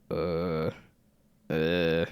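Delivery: low, loud groaning zombie moan. Pretend. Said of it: "I wanted to get the zombie moans".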